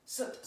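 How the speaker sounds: "Sut" snapped from the navel; punchy "Sut" with a hard T; emphatic sut. Shown as a demonstration. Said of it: "so you go sut".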